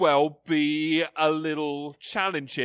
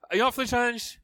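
higher, livelier challenge to the crowd; more enthusiastic; 8 semitones higher, much faster than usual. Shown as a demonstration. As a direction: push them to get fired up. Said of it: "a bit more enthusiasm is called for".

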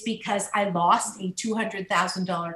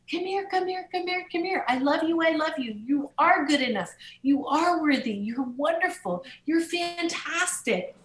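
tender; 6 semitones higher than usual. Pretend. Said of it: "frequency that says".

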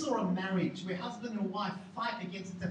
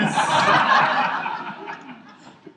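long breathy laugh; 13 dB louder than usual. Real, not acted. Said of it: "Lord Jesus".

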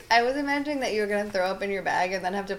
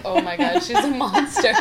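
scared quote through laughter; mock horror with a laugh; 5 semitones higher, 5 dB louder than usual. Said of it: "He was like".

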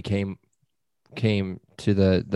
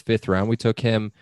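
ordinary talk

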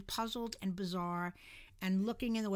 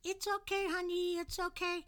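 put-on high, sweet little old lady, much higher; 11 semitones higher, 4 dB louder than usual. Babushka lady soothing the apologetic narrator, high sweet voice. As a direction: coo it like a kindly babushka.

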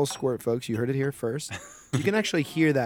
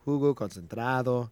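clumsy Spanish accent reading a label; hammed-up Spanish accent, slowed on carbonatada; bad Spanish accent. Shown as a demonstration. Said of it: "that was the worst Spanish accent ever".

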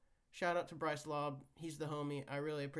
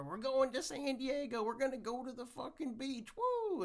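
excited tourist; 8 semitones higher, 4 dB louder than usual. Retold or imitated. Mocking summer tourists.

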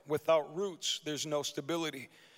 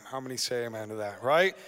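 normal talking voice

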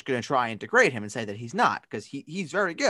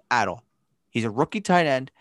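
usual speaking voice

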